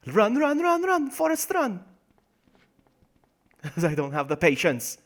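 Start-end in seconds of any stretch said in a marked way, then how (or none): 0.1-1.8 high, rising cries of urging; excited, high-pitched egging on; urgent cheering on. Acted. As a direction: call out frantically to a runner. Urging on with a film catchphrase.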